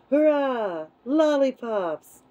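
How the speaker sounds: high, drawn-out hurrah; shouted cheer. A narrator's reading. Chorus of crocodiles cheering for lollipops, excited shout. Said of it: "a whole chorus of voices shouted".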